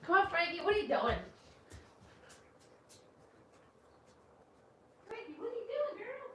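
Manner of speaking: high sing-song voice to a dog; pet talk. Real, not acted. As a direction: coo at the dog. Talking to her dog.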